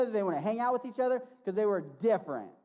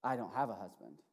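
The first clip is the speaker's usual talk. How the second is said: 1 low and quiet; 9 semitones lower, 5 dB quieter than usual. Acted. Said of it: "she goes".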